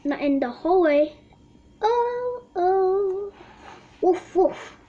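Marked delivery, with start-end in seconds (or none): 1.8-4.8 high, light mock barking; lightly voiced pet dog; child plays Daddy's pretend dog; playful dog.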